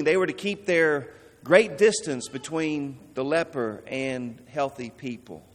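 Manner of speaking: usual speaking voice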